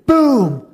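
loud spoken boom; preacher's mock boom; booming sound effect. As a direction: drop it like a thunderclap.

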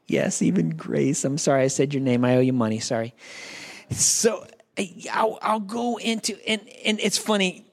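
ordinary delivery

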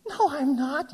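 high-pitched mock protest; indignant denial. Acted argument with counselee.

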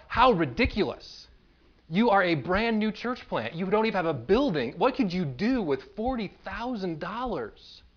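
recalled self-righteous indignation; indignant disbelief. Retold or imitated. Acts out his indignant inner criticism.

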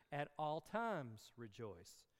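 slow and deliberate, "times" stressed; emphatic, slow. Shown as a demonstration. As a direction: land firmly on "times". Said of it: "the emphasis again being on".

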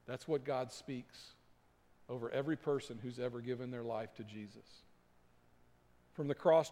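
ordinary delivery

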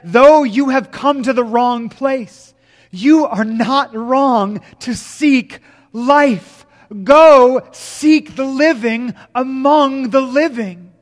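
preacher's rising slow climax; climactic preaching. Acted. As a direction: lift it into a soaring pulpit plea. Sermon climax to congregation.

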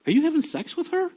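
pointed question rising at the end; retold pastor's blunt question to his friend. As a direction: ask it straight out, pitch climbing.

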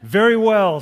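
loud, high, commanding; God speaks from the sky, loud and final; booming divine voice. A narrator's reading. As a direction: voice of God answers the atheist, booming. God's voice answers the atheist.

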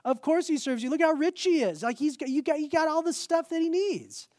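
high, scoffing protest; Satan. Acted. Mocking accuser objecting to God about Job, high-pitched protest. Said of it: "And he goes".

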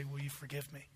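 very soft, low, humble request; hushed plea. Acted. Father to his children who accepted Jesus, hushed plea. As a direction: ask forgiveness almost under your breath.

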